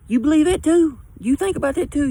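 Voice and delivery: high, loud mock excitement; mock excited. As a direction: squeal it like a gullible friend. Mocking excited agreement.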